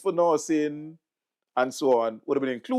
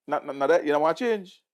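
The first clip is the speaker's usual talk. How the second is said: ordinary talk, nothing marked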